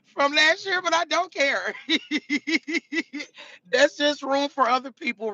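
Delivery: high-pitched, breaking into a giggle; laughing it off. Real, not acted. Streamer shrugging off lost followers, laughing. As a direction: toss it off and end laughing.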